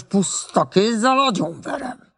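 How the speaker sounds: measured menace, each word stretched out; menacing threat. A narrator's reading. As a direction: threaten slowly and very convincingly. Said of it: "Hangja igen meggyőző volt".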